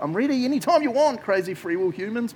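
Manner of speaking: high, loud playful God voice; eager, booming God; voicing God. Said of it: "he's like".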